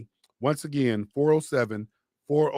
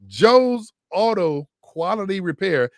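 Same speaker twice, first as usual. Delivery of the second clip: announcer plug; 4 semitones higher, 6 dB louder, slower than usual. Acted. Announcing the sponsor's name.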